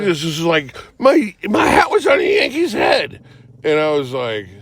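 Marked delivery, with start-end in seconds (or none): 1.0-3.2 high, strained mock wail; whine-scream it like an outraged toddler. Friend defends snatching the hat from a Yankee, toddler-like wail. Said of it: "he just screams at me like, like a toddler".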